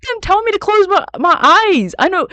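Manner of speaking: high, loud excited reaction. Real, not acted.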